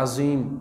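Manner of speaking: عظیم said azim, with a plain z instead of ظ; عظیم voiced as azim, the wrong way; wrong z sound. Shown as a demonstration. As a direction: say عظیم with a clear z, the mistake. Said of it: "یہ زا نکل رہا ہے".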